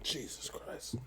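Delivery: very soft, voiceless muttered oath; stunned, under the breath; appalled whisper. Real, not acted. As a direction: whisper it in dismay. Appalled by the roster.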